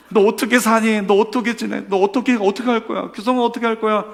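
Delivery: mock wail, high and loud; imitated sobbing. Friend crying with a dumped classmate, imitated wailing. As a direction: wail in sympathy, acting out the tears. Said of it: "같이 엉엉 울면서 제가 얘기했어요".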